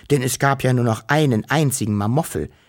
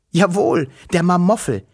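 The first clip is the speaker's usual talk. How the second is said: exclaiming; 6 semitones higher, 4 dB louder than usual. A narrator's reading. Narrator exclaims monster's return.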